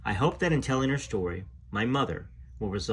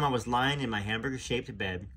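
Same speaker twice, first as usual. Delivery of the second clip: ordinary delivery, nothing marked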